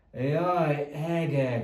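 loud exasperated groan; hangos, lemondó sóhaj; exasperated sigh. Acted. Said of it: "sóhajtotta doktor Reaper".

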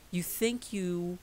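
ordinary delivery

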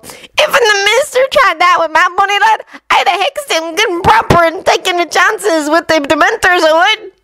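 high cackling hag; screechy old witch. Acted. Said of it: "shouted a hag from a nearby table with a cackle".

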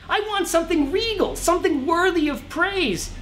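high, whiny king complaining about his clothes; pitiful pouting king. Acted. King whining to the shaman about his clothes, pouting. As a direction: pout through every word like a sulking royal. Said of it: "with a pitiful pout".

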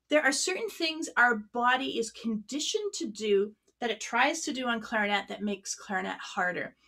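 ordinary talk, nothing marked

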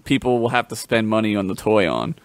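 ordinary talk, nothing marked

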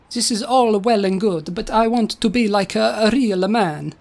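mock-Italian robot voice; stagey Italian with added vowels. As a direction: plead in a cartoon Italian accent. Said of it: "stereotypical Italian accents".

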